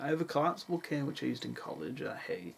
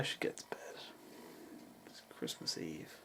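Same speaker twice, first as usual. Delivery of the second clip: soft late-night whisper; quiet whisper on Christmas Eve; 7 semitones lower, 10 dB quieter than usual. Real, not acted. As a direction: hush it, everyone's asleep.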